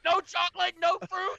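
high, whiny put-on voice mocking a friend; mocking Mike. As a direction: squeak it out like a picky kid. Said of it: "Like, you like".

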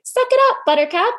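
high, loud mock brush-off; tough-love quip. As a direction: toss it off like a bossy cheerleader. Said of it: "I was like".

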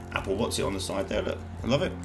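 normal talking voice

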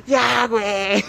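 mock yell. Heckler at a friend's public declaration, mock yell. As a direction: mimic someone yelling, but not at full volume. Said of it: "sí le estaba gritando".

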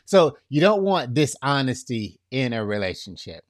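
usual speaking voice

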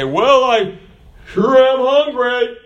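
high, slow put-on hungry whine; hinting mooch. Hinting to the people listening, a mock hungry whine. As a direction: drop a mock hint that you want food.

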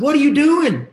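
very high, loud yell; incredulous shout. Retold or imitated. Dad reaching son who stopped climbing, high incredulous shout. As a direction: cry it out in loud disbelief.

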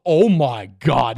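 mock-horrified slow oh my God; horrified disbelief. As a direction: drag it out slowly and loudly, aghast. Reliving horror at the scene.

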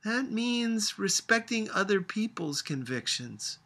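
lightly voiced grandmother, patient; a hint of an old woman explaining. A narrator's reading. Grandmother explaining tolerance to Sophia, patiently. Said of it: "grandmother explaining to the child".

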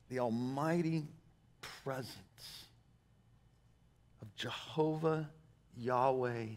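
soft, tender appeal to the congregation; hushed earnest preaching. Real, not acted. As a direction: let it sink low and reverent, pausing between phrases.

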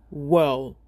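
giant voice. Acted. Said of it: "said the giant".

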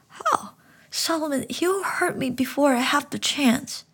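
put-on boy confessing fear to the frog; scared child. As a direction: admit it close to tears. Boy frightened protest.

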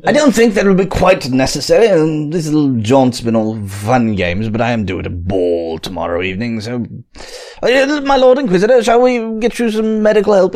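a haughty noble speaking with lofty courtesy; posh character accent. Acted. Inquisitor's companion to the lord, in a lofty posh accent. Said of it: "that's your accent".

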